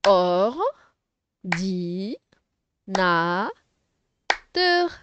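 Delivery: "Or-di-na-teur" very slow, four stretched beats with pauses; syllable by syllable. Shown as a demonstration. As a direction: sound out "Or-di-na-teur" one syllable at a time. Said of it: "Mais juste en écoutant".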